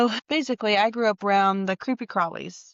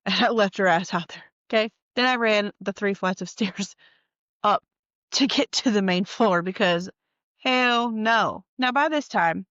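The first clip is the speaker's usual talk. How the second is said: ordinary delivery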